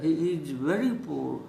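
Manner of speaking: very slow, each word clear; slow model sentence. Shown as a demonstration. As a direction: model it slowly for learners. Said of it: "slow model sentence". (धीरे-धीरे उदाहरण वाक्य)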